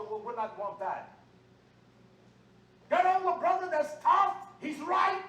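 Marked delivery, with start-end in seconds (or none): 2.9-5.2 high, loud preacher's shout; full-force sermon shout; fiery preaching. Real, not acted. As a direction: thunder it at the congregation.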